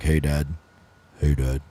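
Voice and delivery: very low, soft casual impression; Sagan. Host mimicking deep-voiced friend greeting dad, low impression. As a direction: drop deep and keep it quiet. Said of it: "Sagan's voice is low as shit".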